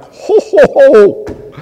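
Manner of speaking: high, loud mock jolly "Ho, ho, ho"; mock delighted ho ho ho. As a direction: ham up a gleeful laugh over honey. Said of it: "I read that and I'm like".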